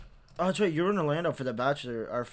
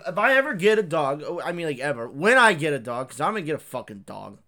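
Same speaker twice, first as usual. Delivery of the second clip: ordinary delivery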